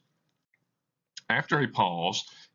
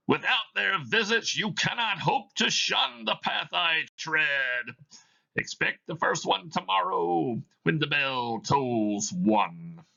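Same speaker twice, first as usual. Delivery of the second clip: a chain-bound spirit intoning its doom; Marley's ghost; 9 semitones higher than usual. Acted. Reads Marley's ghost in the play.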